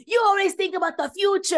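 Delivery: high, loud mocking husband. Husband mocked in a high, loud whine. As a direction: squeal it like a petty spouse. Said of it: "He's like".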